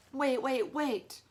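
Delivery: loud stop call; yelled. A narrator's reading. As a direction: yell to cut them off. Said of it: "yelled the guy".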